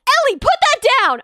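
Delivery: loud, high mock yell; scolding mom. Mom to a child off screen, imitated scolding yell.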